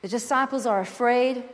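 usual speaking voice

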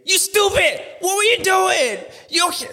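high furious yelling; angry parent shout. Retold or imitated. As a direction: yell at the kid in fury. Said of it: "I'm like".

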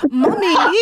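imitating a small son's high British "Mommy"; high-pitched, playful mock-British call; British accent. Mother imitating her five-year-old son, British accent. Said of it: "takes on this British accent".

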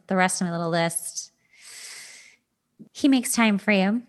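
ordinary talk

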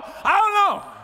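"don't" yelled high and loud, falling off; high shouted I don't know. Acted. Preacher shouting to the congregation, high and loud. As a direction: shout it up high, voice cracking with excitement.

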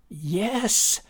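put-on high, rising boyish yes; insistent. A narrator's reading. Boy to his doubting dad, insistent. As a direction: insist eagerly.

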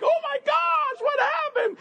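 hammed-up high-pitched shriek of shock; exaggerated falsetto panic; mock horror. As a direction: squeal it in comic alarm, way up high. Acting out shock at magnified pores.

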